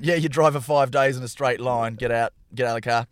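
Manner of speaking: ordinary delivery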